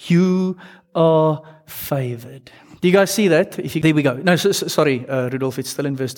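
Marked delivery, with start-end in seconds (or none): 0.1-2.5 slow, deliberate, every word weighted; slow emphasis. Shown as a demonstration. Preacher reading the angel's words to the congregation, slow emphasis. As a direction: let each word land. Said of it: "this is what I want you to hear".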